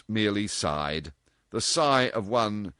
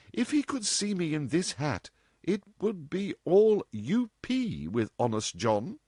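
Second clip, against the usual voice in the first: put-on a higher, playful young woman; lightly voiced cheeky girl; 9 semitones higher than usual. A narrator's reading. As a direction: tease her mother lightly. Dorothy voiced in story.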